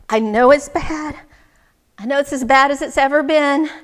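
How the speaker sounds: loud, emphatic admission; strained insistence. Acted. Man appealing to wise woman, strained and insistent. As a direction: press each admission forcefully.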